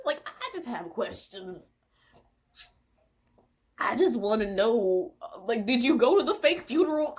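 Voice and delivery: usual speaking voice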